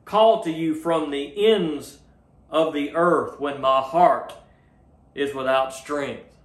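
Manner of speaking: slow, measured verse reading in emphatic phrases; scripture quotation. Acted. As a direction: read it slowly, pausing between phrases. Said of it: "in verse two, he says".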